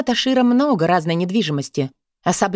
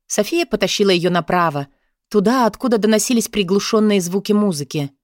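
plain reading voice

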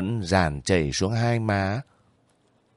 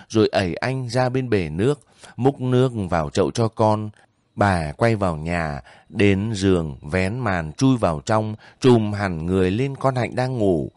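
ordinary delivery, nothing marked

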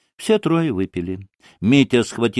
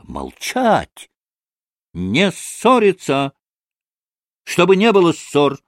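commands rising high and louder; imperious cry; 8 semitones higher, 4 dB louder than usual. A narrator's reading. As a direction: order them to stop, slowly and forcefully. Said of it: "крикнула повелительно Грушенька". (повелительный окрик)

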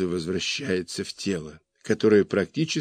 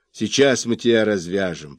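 ordinary delivery, nothing marked

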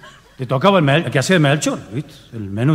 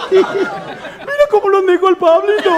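very high, slow, laughing line; giggling kid; slower than usual. Retold or imitated. Kid cracking up on stage, high giggling voice. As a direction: squeal it through giggles. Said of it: "Se entró a tentar".

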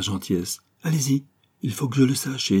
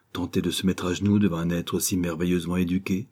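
usual speaking voice

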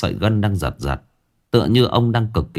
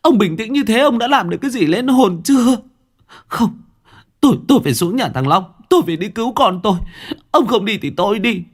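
shrill panicked rage at husband; panicked angry outburst; 12 semitones higher than usual. Acted. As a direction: berate husband in desperation. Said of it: "tâm thần cực kỳ hoảng loạn".

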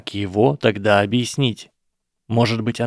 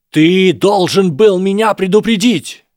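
much higher, much louder, slow yell; full shout; 10 semitones higher, 8 dB louder than usual. A narrator's reading. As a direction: hurl the blame at full volume. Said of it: "Голос Драко становился всё громче".

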